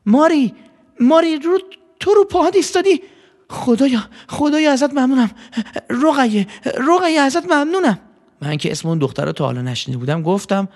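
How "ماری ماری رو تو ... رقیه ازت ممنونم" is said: joyful shout. Acted. Mother cries out to her daughter standing again, joyful high shout. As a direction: call out to her daughter, overjoyed and much higher. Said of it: "فریاد زد".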